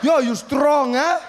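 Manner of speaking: put-on loud, high, laughing drunk shout; drunk uncle. Drunk uncle impressed by his nephew, loud laughing shout. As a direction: roar it with drunken delight. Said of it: "And he's like".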